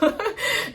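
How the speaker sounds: a short high burst of laughter. Real, not acted. Laughing high at her own joke about conditional love. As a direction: laugh out at the punchline.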